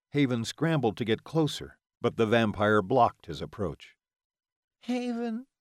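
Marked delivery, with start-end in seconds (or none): 4.8-5.5 high, soft pained call; a wounded mother calling her son's name softly; strained cry. A narrator's reading. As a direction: call his name through the pain. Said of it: "his mother's strained voice cried out".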